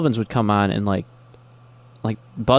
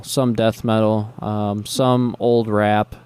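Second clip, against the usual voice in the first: ordinary talk, nothing marked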